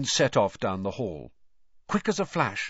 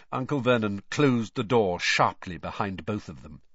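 plain reading voice

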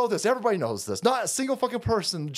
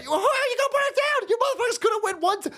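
mock shout; 11 semitones higher, faster than usual. Mocking shouted challenge.

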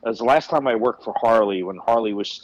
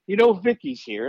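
bad Terry impression; 9 semitones higher than usual. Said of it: "I do a terrible Terry".

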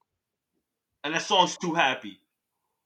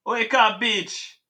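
raised, commanding quote; higher-pitched mock wake-up call; imitated mystery voice; 6 semitones higher, 5 dB louder, much slower than usual. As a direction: imitate a voice ordering someone awake. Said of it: "all I hear is his voice say".

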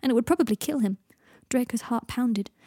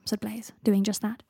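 ordinary delivery, nothing marked

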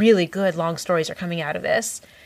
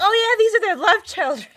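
high, gushing fangirl; gushing fan voice; 13 semitones higher than usual. Retold or imitated. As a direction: gush like an excited fan. Imitating fans.

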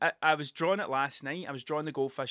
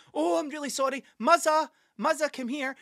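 flustered dog owner; 10 semitones higher, 5 dB louder than usual. Retold or imitated. Imitating dog owner calling dog.

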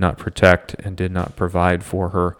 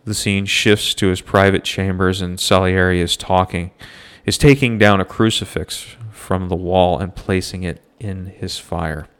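ordinary talk, nothing marked

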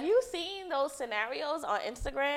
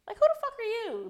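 outraged; 12 semitones higher, much faster than usual. Real, not acted. Outraged rhetorical question.

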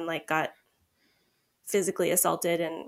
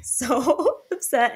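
laughing; 6 semitones higher, 4 dB louder, much slower than usual. Real, not acted. Laughing while retelling.

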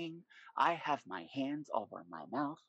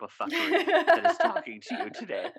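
laughing; 9 dB louder than usual. Real, not acted. Laughing self-mockery.

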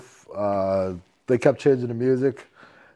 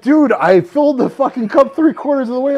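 exasperated protest; 12 semitones higher, 7 dB louder than usual. Retold or imitated. Retold exasperated protest mid-game.

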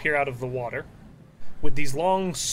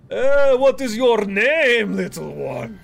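slow, loud, raised giant voice easing off at the end; storm giant; 6 semitones higher, 7 dB louder, slower than usual. Acted. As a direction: ask a tiny stranger in a big drawn-out voice. Game master voices the storm giant.

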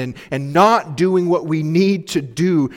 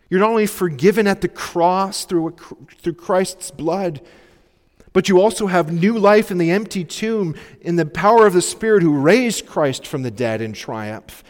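preaching; 6 semitones higher, 5 dB louder than usual. Real, not acted. Preaching builds to full force.

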